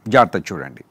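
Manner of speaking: ordinary talk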